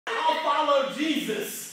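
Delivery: high, loud, slow mock pledge; mock lazy believer. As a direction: drawl it out like a half-hearted follower.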